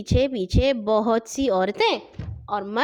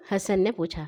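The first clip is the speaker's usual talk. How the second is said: ordinary delivery, nothing marked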